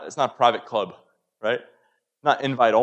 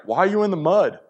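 high, loud, mock-scolding father's question; 10 semitones higher, 7 dB louder than usual. Preacher mocking a scolding father, high and loud. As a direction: ham up a scolding dad, pitched high and loud. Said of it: "he's not gonna be like".

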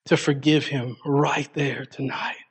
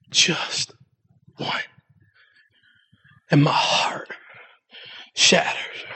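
soft, breaking whisper on the edge of crying; near tears; 4 dB quieter, slower than usual. Real, not acted. Preacher thinking of his absent dad, tearful whisper. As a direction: let the voice crumble into a tearful whisper. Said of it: "so if I cry, I apologize".

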